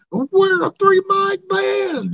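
high, slow, loud cheer; drawn-out high yell; excited shout. Real, not acted. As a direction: proclaim it like a big reveal. Excited shout of band name.

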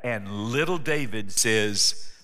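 ordinary talk

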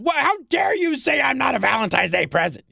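mock outraged listener email; mock outraged ladies. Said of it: "here come the emails from the ladies going".

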